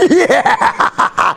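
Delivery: very high, drawn-out gleeful yeah with laughter; imitated excited child. Proud toddler answering dad about his cans, overjoyed. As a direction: crow it like a triumphant little boy. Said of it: "He just got so excited".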